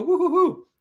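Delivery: a high sing-song woo-hoo-hoo; playful imitation of a cheer; imitating his woo-hoo. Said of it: "So he'll go".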